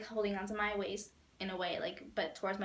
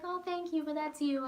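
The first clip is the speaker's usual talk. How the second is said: higher sweet reply; imitating his mom; 6 semitones higher than usual. Mom answering the fan's compliment in a higher sweet voice. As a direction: coo it back modestly.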